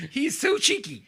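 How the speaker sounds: very high, loud cutesy squeal; mock-fond gushing. Co-host pretends the killer is adorable, mock-fond gushing. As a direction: coo over a naughty rascal, laughing.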